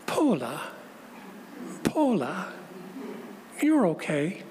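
put-on gently reassuring in a light New Zealand accent; a hint of Kiwi vowels, calm and firm; soft New Zealand reassurance. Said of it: "it was a Kiwi accent".

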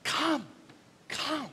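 soft, hushed come; breathy lover calling softly; lover's soft call. Acted. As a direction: coax like a tender lover. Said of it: "like any lover and says".

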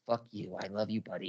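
low, soft, intimate imitation; hushed mock affection. Red sun stabs you in the heart, hushed mock affection. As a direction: murmur it low, like a false friend. False friend stabbing, then professing love.

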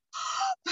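sharp, high gasp of dismay; shocked gasp. Retold or imitated. Retelling watching Terminator with her brothers, a shocked gasp. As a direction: gasp as if the robot died.

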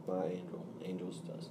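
soft, close whisper into the mic; giggly prank whisper. Real, not acted. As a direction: hush it near the mic, playful. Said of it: "I whisper into the mic".

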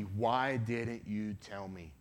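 very slow, low, weighty question; slow, sober reproach without shouting; grave restatement. Retold or imitated. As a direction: let each word sink in, drawn out and low. Same question restated after the scream.

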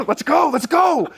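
rally them, full of excitement; excited shout. Retold or imitated. Urging buddies on the hunt.

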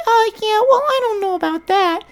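put-on very high, squeaky nasal girl; nasally girl voice. Girl chatting with him, squeaky nasal mock voice. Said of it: "like, a nasally voice".

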